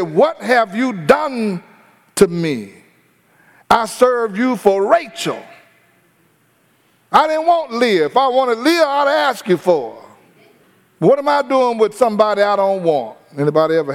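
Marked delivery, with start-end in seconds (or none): 0.0-5.4 aggrieved protest. Acted. Jacob confronts Laban. 7.1-8.0 Jacob's high, heated complaint to Laban; indignant protest. Acted. Jacob confronts Laban angrily. 8.0-13.2 indignant protest. Acted. Jacob confronts Laban.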